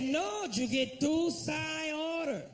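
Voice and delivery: put-on a cashier struggling with the order in a Spanish accent; hammed-up Spanish-accented cashier; mock Spanish accent. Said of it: "He said".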